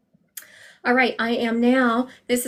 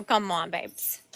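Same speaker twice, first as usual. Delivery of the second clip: gentle coaxing; 3 semitones lower, 7 dB quieter, much slower than usual. Real, not acted.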